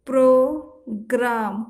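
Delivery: प्रोग्राम slow and loud, each cluster clear; careful demo. Shown as a demonstration. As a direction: spell out the clusters for the class. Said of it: "इस तरीका से प्रोग्राम".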